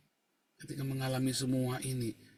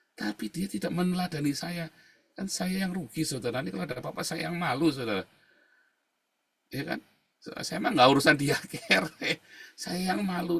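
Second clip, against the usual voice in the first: ordinary delivery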